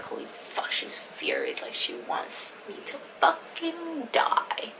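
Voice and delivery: hushed, drawn-out dramatic panic; whispery start stretching into a high, slow mock wail; retold dread. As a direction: act out horrified disbelief, slow and under the breath. Retold dread on reading the text.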